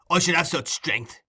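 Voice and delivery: ordinary delivery, nothing marked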